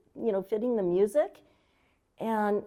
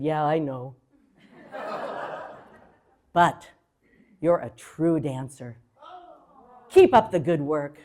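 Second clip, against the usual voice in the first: lower, easygoing man's voice with a chuckle; Gregory Hines; 7 semitones lower, 4 dB louder than usual. Retold or imitated. Gregory Hines encouraging the beginner, lower and amused. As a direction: lightly voice him, amused and kind. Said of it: "He said".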